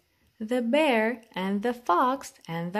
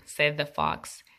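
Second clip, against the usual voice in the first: plain reading voice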